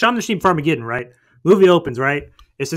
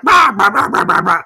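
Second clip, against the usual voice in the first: loud, high bah bah bah; robot bleating; 5 semitones higher, 7 dB louder than usual. Retold or imitated. Retelling a film to a friend, bleating like the robot. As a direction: bleat it like a giant cartoon robot. Robot voiced in retold movie.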